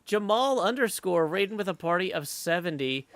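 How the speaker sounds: normal talking voice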